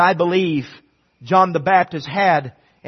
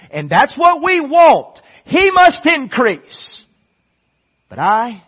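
louder, higher, slowing on must increase; preaching at full force; 8 semitones higher, 8 dB louder, slower than usual. Real, not acted. Preacher lifting the congregation, full-force preaching. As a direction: proclaim it to the back row.

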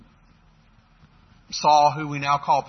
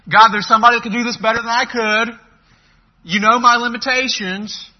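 whiny excuse; 7 semitones higher, 9 dB louder than usual. Retold or imitated. Mocking Moses' excuse.

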